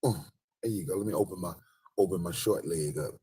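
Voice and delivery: ordinary talk, nothing marked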